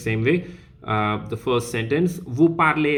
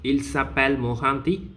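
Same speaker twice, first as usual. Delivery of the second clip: "Mohanty" rising at the end; question intonation. Shown as a demonstration. Teacher turning a statement into a question, tone rising on "Mohanty". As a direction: lift the end of "Mohanty" to ask it. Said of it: "the tone raise".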